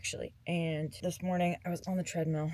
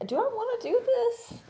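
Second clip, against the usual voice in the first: high doubtful inner voice; 4 dB louder than usual. Retold or imitated. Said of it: "And I'm just kinda like".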